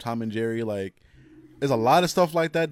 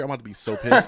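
laughing; 7 dB louder than usual. Real, not acted. Laughing mock outrage.

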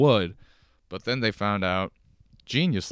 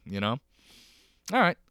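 ordinary talk, nothing marked

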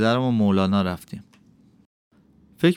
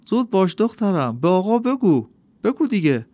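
high, louder urgent father's voice; anxious father pleading; 8 semitones higher, 4 dB louder than usual. A narrator's reading. Father pushing his shy daughter to answer the master, urgent. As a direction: press the girl to answer, voice raised.

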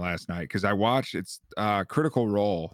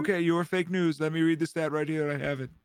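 mock Trump, higher and pushy; 8 semitones higher than usual. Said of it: "he's like".

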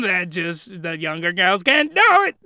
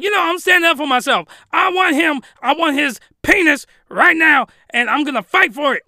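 put-on high, loud, fast girly voice; mock needy girl; 7 semitones higher, 6 dB louder than usual. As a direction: ham up a desperate girl. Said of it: "glasses chick is like".

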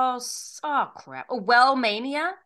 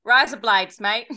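louder, hammed-up Australian greeting; mock Australian accent; 5 dB louder than usual. Pretending to greet people in Australia, mock Australian accent. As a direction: play at being an Aussie local. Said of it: "when I go to Australia, I'm just gonna go".

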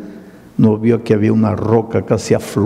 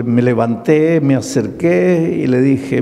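ordinary delivery

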